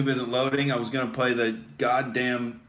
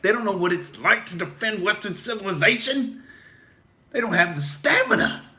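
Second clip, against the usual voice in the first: higher, pumped-up mock hero voice; mock patriot rant; 5 semitones higher than usual. Host hamming up defending Western civilization. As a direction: puff up like a cartoon patriot.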